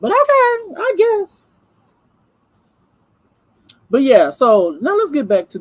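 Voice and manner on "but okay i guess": much higher, far-off sarcastic okay; high mock resignation; sarcastic shrug. Real, not acted. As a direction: let it go with a dismissive sarcastic lilt. Sarcastic dismissal after rant.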